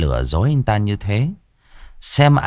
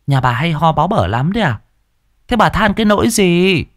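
sneering wife; 8 semitones higher than usual. Acted. Wife sneers at mother-in-law.

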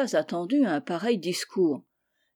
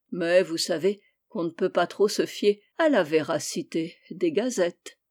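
ordinary delivery, nothing marked

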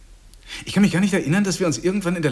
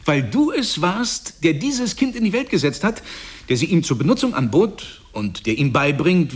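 emphatic preaching; 6 semitones higher than usual. Acted. Vater predigt voller Pathos.

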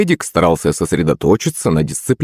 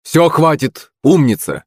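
high, pleased call to stop; approving mild shout; 9 semitones higher, slower than usual. A narrator's reading. Teacher stopping a girl's pull-ups, calling out approval. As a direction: call out praise with raised pitch. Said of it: "крикнул Хрякин".

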